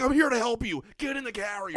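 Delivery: high-pitched reenacted yelling; exasperated yelling. Man retells ordering cat into carrier, yelling. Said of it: "Me yelling at the cat".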